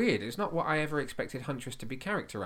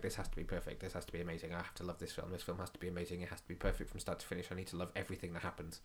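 anxious inner voice; 3 semitones lower, 9 dB quieter, faster than usual. Retold or imitated. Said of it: "doing that thing where I was like".

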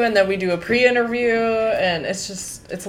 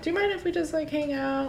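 high, soft pleading tone; sweet high voice; 9 semitones higher, 5 dB quieter, faster than usual. Retold or imitated. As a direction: ask it sweetly, pitched up. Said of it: "I'd be like".

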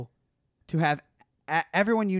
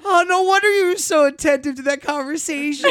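much higher and louder, tearful; excited realization; 11 semitones higher, 9 dB louder than usual. Retold or imitated. Friend realizing why she listened so closely, tearful delight. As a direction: connect the dots with delight. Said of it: "And he goes".

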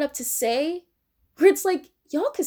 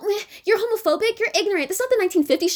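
lightly pitched-up sneering imitation of a preachy liberal; mocking liberal voice; 7 semitones higher, much faster than usual. Ranting at liberals, parodying their scolding in a mock voice. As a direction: mimic the lecturing tone, higher.

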